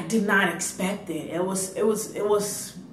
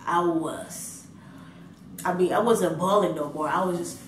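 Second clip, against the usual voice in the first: ordinary talk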